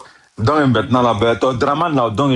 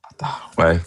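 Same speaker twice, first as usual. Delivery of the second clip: whisper; 7 semitones lower than usual. Real, not acted. Whispered aside during live call.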